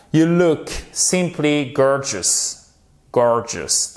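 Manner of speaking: You look simply gorgeous. said slowly and clearly; slow model sentence. Shown as a demonstration. (phrase modèle, lente et articulée)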